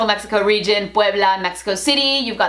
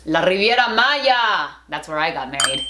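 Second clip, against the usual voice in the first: usual speaking voice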